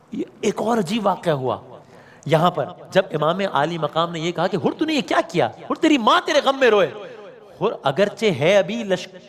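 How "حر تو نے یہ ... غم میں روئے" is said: higher, fast rebuke; extreme displeasure. Retold or imitated. Preacher voicing the Imam rebuking Hurr, higher and rushed. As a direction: voice the Imam's sharp rebuke, high and quick. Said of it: "extreme ڈسپلیزر کا اظہار".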